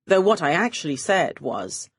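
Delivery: ordinary delivery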